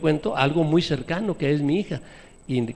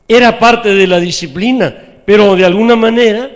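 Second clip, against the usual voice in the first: loud, higher sermon cry; preaching at full force; 5 semitones higher, 13 dB louder than usual. Acted. Preacher confessing his cruelty to the church, loud. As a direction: proclaim it to the church.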